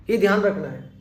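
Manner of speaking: ordinary delivery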